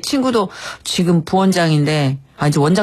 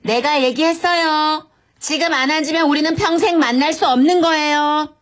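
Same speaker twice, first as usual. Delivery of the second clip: higher-pitched, firm warning; 8 semitones higher than usual. Acted. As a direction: warn him like a disobedient pupil. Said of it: "오은영 박사님 같은 단호함".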